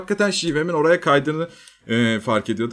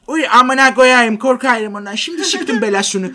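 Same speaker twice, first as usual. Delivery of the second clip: exaggerated Karadeniz accent, pitched up and drawn out; 7 semitones higher, 5 dB louder than usual. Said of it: "iyice şivesinin gerçekten komik olsun diye".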